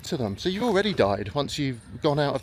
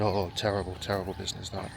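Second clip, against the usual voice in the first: hushed, lower, softer grim mutter; shuddering; 7 semitones lower, 7 dB quieter than usual. Retold or imitated. Drinkers recalling a dead regular, hushed shudder. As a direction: say it with a shudder, dropping away. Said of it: "they all sort of shuddered and said".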